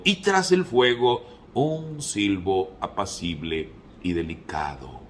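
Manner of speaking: high, swelling phrases dropping back on each refrain; dramatic scripture reading. Acted. Preacher reading Elijah's mountain encounter to the congregation, dramatic scripture reading.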